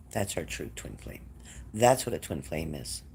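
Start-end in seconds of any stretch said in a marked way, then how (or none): 0.1-1.2 soft echo. Shown as a demonstration.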